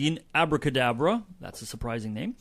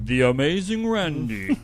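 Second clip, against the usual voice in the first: slow, louder showman billing; announcer voice; 4 dB louder than usual. Pretend. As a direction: roll out the stage name. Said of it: "See how many times I can put that in there".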